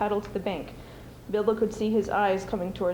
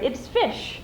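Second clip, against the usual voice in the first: Gollum triumphant; 4 semitones higher than usual. Acted. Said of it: "he cried".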